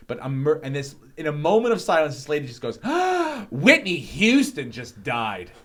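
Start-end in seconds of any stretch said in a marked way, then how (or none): 2.8-3.4 high, sharp gasp of horror; shocked gasp. Retold or imitated. Lady's shocked news mid-show. 3.5-5.5 imitating a horrified lady, slow and swooping; aghast announcement. Lady breaks shocking news mid-show.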